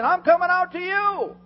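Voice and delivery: high, loud, excited reasoning; eager Peter. Retold or imitated. Preacher voicing Peter's eager plan to the congregation. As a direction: talk yourself into it eagerly. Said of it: "he said".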